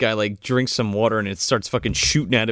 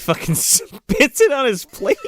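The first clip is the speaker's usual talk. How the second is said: breaking into laughter while telling; slowed down by giggles; trying to tell it through a laugh; 12 semitones higher, slower than usual. Real, not acted. Teller cracks up at retold scene.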